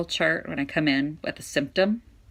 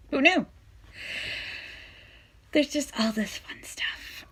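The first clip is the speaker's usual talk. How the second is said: sarcastic whispered aside; whispering; 11 semitones higher, 4 dB quieter than usual. Real, not acted. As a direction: whisper it wryly. Sarcastic aside about disease.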